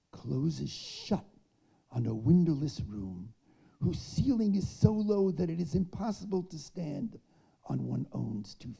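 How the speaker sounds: reading a rally speech with rising force; formal speech read. Shown as a demonstration. Eulogist reads her Solidarity Day speech as oration.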